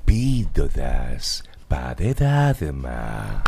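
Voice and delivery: very slow, low, subtle delivery; sensual. Shown as a demonstration. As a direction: purr it like a seductive radio host. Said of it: "Más sensual".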